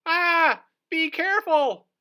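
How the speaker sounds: very high, loud barked warning; cartoon seal bark. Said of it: "barked Seely Sally".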